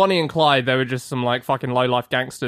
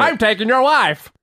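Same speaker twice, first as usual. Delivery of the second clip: mock outlaw boast; 8 semitones higher, 5 dB louder than usual. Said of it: "He's like".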